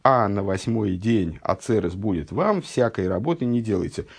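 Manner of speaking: ordinary talk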